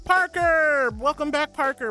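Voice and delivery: loud, high, thrilled welcome; excited shout. Real, not acted. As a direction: call out his name with joy. Excited greeting to returning viewer.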